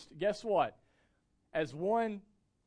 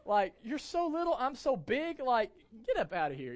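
much higher, hammed-up indignant dad; joking dad scolding his two-year-old, mock outraged; 8 semitones higher, 4 dB louder than usual. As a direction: fake outrage at a tiny kid. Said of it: "And be like".

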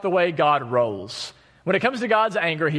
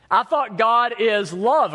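raised, puzzled "I thought God is love"; higher, slow questioning "love"; doubtful question; 6 semitones higher, slower than usual. Shown as a demonstration. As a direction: ask "God is love" as if it can't square with hate. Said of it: "And that is true".

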